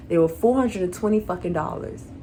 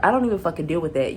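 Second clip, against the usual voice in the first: ordinary talk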